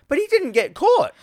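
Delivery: high, squeaky mock disbelief. Friend squeaks a film plot objection, disbelieving. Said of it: "I'm like".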